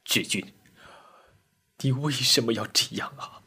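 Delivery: understated crying voice; tearful. A narrator's reading. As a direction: ask through tears, quietly. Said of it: "哭着说".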